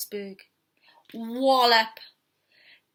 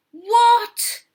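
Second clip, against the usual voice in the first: shocked what; 11 semitones higher, 9 dB louder than usual. Acted. Cub shocked.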